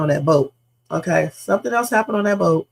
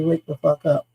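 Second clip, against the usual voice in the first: casual wake-up call; 3 semitones lower than usual. Retold or imitated. Coworker slacking off, told to wake up, offhand. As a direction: toss it off without urgency. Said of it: "somebody not doing their job and say".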